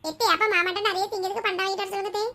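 put-on high-pitched kid's request; child's voice. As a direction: ask your aunt for treats like a kid. Child figure asks for snacks. (உயர்ந்த குழந்தைக் குரல்)

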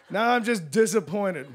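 normal talking voice

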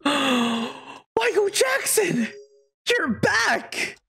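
hammed-up astonished delight; mock amazement. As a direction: hail a returning idol, overacting.

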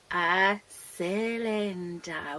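very slow, stretched syllables; drawn-out teacherly answer; slow chorus answer. Real, not acted.

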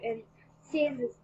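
ordinary delivery, nothing marked

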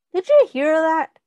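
usual speaking voice

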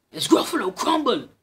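spring the trap with a bang; triumphant shout. Acted. Mouse scares the Gruffalo, loud high shout.

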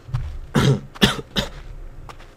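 real coughing, choking on air; coughing fit. Real, not acted. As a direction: cough hard, short of breath. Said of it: "I'm choking on air".

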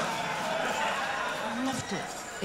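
whispered, slow, savouring it; dreamy whisper. Real, not acted. Comedian confessing to the crowd, slow dreamy whisper. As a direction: breathe it out, very slow and rapt.

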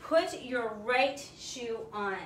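ordinary talk, nothing marked

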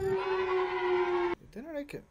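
lightly voiced booming encouragement; mock encouraging mentor. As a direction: cheer the kid on like a hammed-up coach. Encouraging himself in put-on voice.

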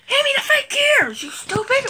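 high, strained scolding; impatient squeal. Real, not acted. Impatient repeat to helper Rachel.

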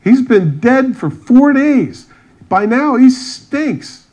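high, drawn-out mock horror; aghast protest. Mourners objecting to opening the tomb, aghast. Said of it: "everybody's aghast".

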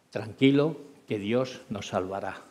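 calmando al sargento, suave y más bajo; da paz con voz baja y serena; tranquilizador. Retold or imitated. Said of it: "le dije al sargento". (piloto calma al sargento asustado, voz baja y serena)

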